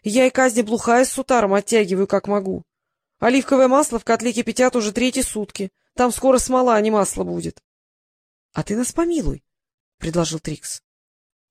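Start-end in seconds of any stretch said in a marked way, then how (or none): none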